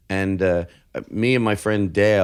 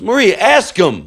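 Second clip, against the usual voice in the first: loud, high, drawn-out prodding; retold pushy command, pitched up; insistent urging; 9 semitones higher, 5 dB louder, slower than usual. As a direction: urge her on loudly, stretching the words. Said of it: "he knew how to sell it".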